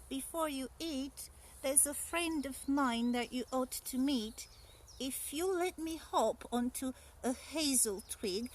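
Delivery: high, sly little mouse; a small clever mouse, scheming. Acted. Mouse tricks the Gruffalo's child, high and sly.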